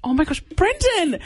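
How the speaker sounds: high, eager burst of excitement; can't wait to spill the news; excited outburst. Real, not acted. Host bursts out to co-host Brendan, excited, high.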